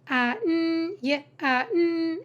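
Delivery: "An, y, an" as onion in separate drawn-out pieces; sounded out. Shown as a demonstration. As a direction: stretch and separate each sound: "An, y, an". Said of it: "That's how you sound out words".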